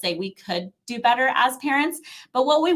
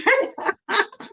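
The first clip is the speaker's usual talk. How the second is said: laugh; 12 semitones higher than usual. Real, not acted. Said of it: "laughing at deadline example".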